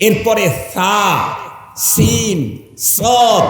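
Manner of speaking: sa, sin and sad each sounded separately; sa, sin, sad distinguished. Shown as a demonstration. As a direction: set the three Arabic s-letters apart. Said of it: "এই তিনটাকে ব্যবধান করে উচ্চারণ".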